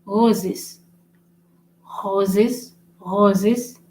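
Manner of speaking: "roses" opened with a guttural French R; throaty French R at the start of "roses". Shown as a demonstration. Teacher showing R at beginning of word, "roses" with guttural R. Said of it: "at the beginning of the word".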